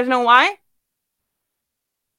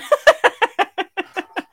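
a high burst of laughter; real laughter, higher; 8 semitones higher than usual. Real, not acted. Speaker laughing at her own cheeky line, high laugh.

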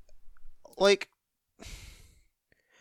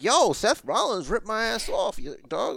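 higher-pitched, swooping mock grievance; mock beefing; 6 semitones higher than usual. Co-host imitates the attacker legit beefing, mock indignation. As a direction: complain like an aggrieved dude. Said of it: "he was legit beefing".

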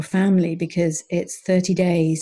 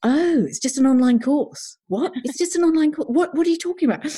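much higher, amused disbelief; relieved; 9 semitones higher than usual. Retold or imitated. Husband relieved it's only an online course, amused. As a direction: laugh it off with relief. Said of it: "you could hear the relief in his voice".

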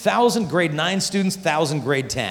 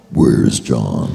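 very low, loud booming dad demand; angry dad; 6 dB louder than usual. Retold or imitated. The dad breaks the door down, low and loud booming demand. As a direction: boom it out like a furious father.

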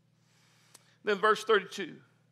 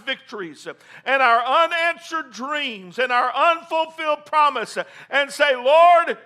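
higher and louder, driving exhortation; full-force preaching; 8 semitones higher, 8 dB louder than usual. Acted. Pastor urges the congregation on, preaching at full force. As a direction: preach it, building hard to the climax.

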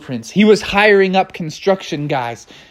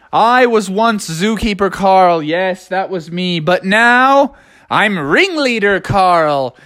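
ringleader pitch; 7 semitones higher than usual. Acted. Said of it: "And Carl said".

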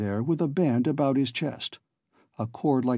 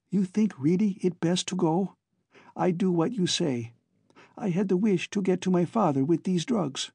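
anxious Glora; 6 semitones higher than usual. A narrator's reading. Glora anxiously asks what to do.

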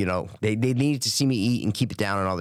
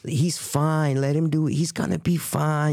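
higher-pitched, imitated Jamaican accent; slow, reassuring Jamaican lady impression; Jamaican nurse; 5 semitones higher, slower than usual. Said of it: "the Jamaican lady was in the room, and she's like".

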